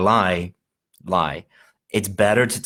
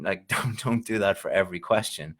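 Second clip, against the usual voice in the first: ordinary delivery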